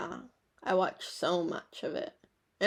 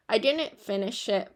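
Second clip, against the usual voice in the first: ordinary talk, nothing marked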